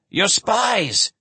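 high, startled gasp of words. Acted. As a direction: blurt it out in alarm. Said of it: "he gasped".

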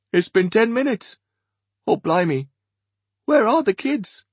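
put-on high, startled older man; Archie, alarmed.

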